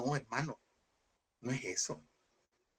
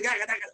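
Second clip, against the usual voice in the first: mock babbling; 5 semitones higher than usual. Mimicking tongues in worship.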